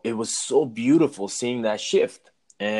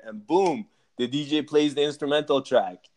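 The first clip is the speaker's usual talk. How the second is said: usual speaking voice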